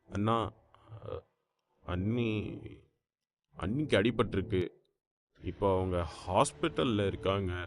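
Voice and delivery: low, hushed, choked-up delivery; tearful. Acted. Younger brother reporting an injury by phone, tearful and hushed. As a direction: break the bad news softly, through tears. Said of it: "இன்னும் அழ ஆரம்பித்தான்".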